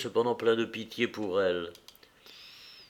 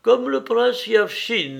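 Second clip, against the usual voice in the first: higher, slow teasing with a smile; smiling tease; 9 semitones higher, 5 dB louder, slower than usual. Acted. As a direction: needle him gently, smiling. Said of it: "dit-elle en souriant".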